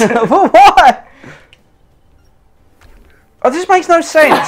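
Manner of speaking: high, laughing protest; laughing disbelief. Real, not acted. Player laughing that the game makes no sense, high laughing protest. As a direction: laugh through the complaint. Laughing at absurd dice losses.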